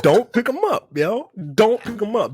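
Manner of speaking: high, urgent call across the room; dad's warning. Retold or imitated. Dad re-enacting warning to wife, high urgent call. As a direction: warn her off, up high. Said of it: "'cause I said the shit".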